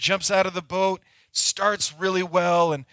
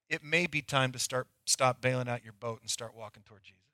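"stop" and "start walking" said softly; 4 semitones lower, 7 dB quieter than usual. Shown as a demonstration. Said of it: "I'm gonna say that to you again".

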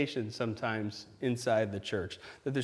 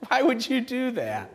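shocked parent; 6 semitones higher, 6 dB louder than usual. Retold or imitated. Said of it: "Every parent in the room is going".

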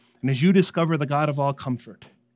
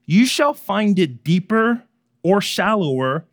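lightly voiced gatekeepers, higher and slow; proclaiming; 5 semitones higher, 6 dB louder, slower than usual. A narrator's reading. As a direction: pronounce it slowly and loudly. Voices the men at the river.

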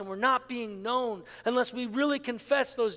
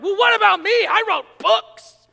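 much higher, louder, indignant outburst; agitated; 9 semitones higher, 13 dB louder than usual. Retold or imitated. Pastor mocking his own jealousy, agitated, high and loud. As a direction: blurt it out like a wounded ego. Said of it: "I don't say it all agitated like that".

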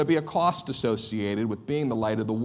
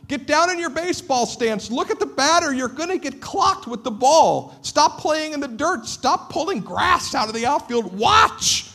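high, loud shouting at distracted kids; coach yelling; 12 semitones higher, 5 dB louder than usual. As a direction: bark orders across the field. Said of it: "I'm constantly yelling at them".

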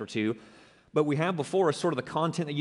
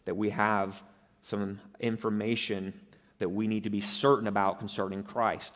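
ordinary talk, nothing marked

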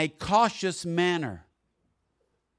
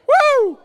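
very high, loud "woo"; piercing whoop of praise; exultant whoop; 16 dB louder than usual. Real, not acted. Preacher overcome while reading the psalm, whooping loudly.

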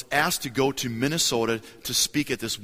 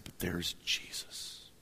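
softer, whispered and slow; hushed awe; 6 semitones lower, 12 dB quieter, much slower than usual. Retold or imitated. Young preacher realizing, hushed whispered awe. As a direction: breathe it out in awe.

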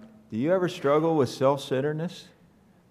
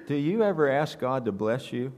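ordinary talk

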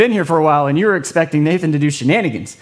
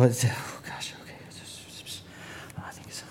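whisper; 6 semitones lower, 16 dB quieter than usual. Real, not acted. Fumbling for the card.

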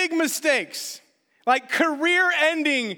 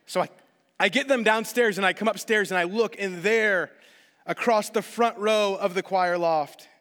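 normal talking voice

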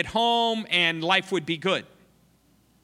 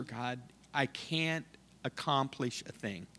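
despairing prayer; 6 dB quieter than usual. Retold or imitated. Missionary weeping alone to God, soft despairing prayer. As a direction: pour out defeat to God. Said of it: "started weeping".

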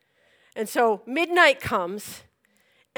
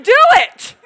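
laughing command; 12 semitones higher, 12 dB louder than usual. Retold or imitated. Urging the water dump.

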